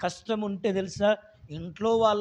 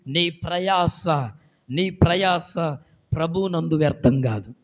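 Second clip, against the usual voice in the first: normal talking voice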